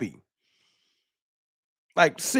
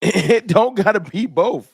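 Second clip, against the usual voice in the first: louder, slower, laughing through it; laughing emphasis; 6 semitones higher, 5 dB louder than usual. Real, not acted. Laughing as he tells fans it needn't be both. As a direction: laugh it out while pressing the point. Laughing, making his point.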